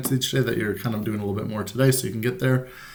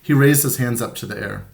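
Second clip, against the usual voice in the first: ordinary delivery, nothing marked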